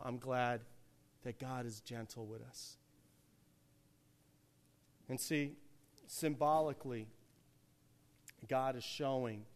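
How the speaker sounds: ordinary delivery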